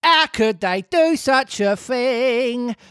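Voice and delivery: lightly voiced fawning innkeeper; polite innkeeper voice. Reads the innkeeper's speech.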